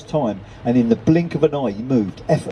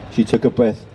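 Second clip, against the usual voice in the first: usual speaking voice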